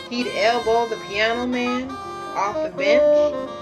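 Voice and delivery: ordinary delivery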